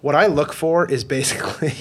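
normal talking voice

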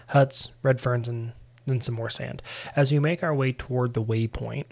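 ordinary talk, nothing marked

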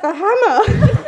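loud, high, drawn-out punchline; laughing quote. Retold or imitated. Brother answers about what to throw, laughing quote. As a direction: stretch it out, bursting with laughter.

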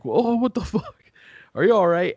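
high, loud, startled cry of concern; alarmed shout. Retold or imitated. Kate seeing him crash, alarmed shout. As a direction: gasp it out as if you just saw a crash. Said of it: "And Kate's like".

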